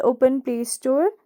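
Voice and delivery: usual speaking voice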